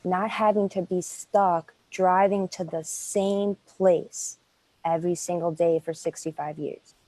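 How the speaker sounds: usual speaking voice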